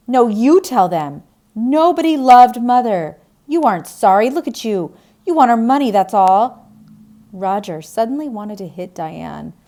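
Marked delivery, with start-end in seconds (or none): 0.1-1.2 loud, higher, pushing him away; angry refusal. Acted. Diane lashes out at Roger. 1.6-3.2 loud, high, bitter accusation; angry accusation. Acted. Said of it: "I was angry and said some angry things". 3.5-6.7 loud, higher, scornful; angry accusation. Acted. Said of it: "I was angry and said some angry things".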